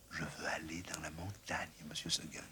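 barely voiced, soft and stubborn; whispering little goat. A narrator's reading. Blanquette telling her master she wants the mountain, whispered. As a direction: breathe the wish in a whisper.

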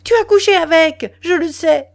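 loud, high accusing shout; furious high-pitched cry. A narrator's reading. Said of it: "Elle cria".

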